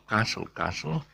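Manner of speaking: "Castle" as KAH-sul, no T; soft S then L in "castle"; silent T. Shown as a demonstration. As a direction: take the T off "castle". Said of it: "just take the T off".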